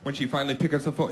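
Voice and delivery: ordinary talk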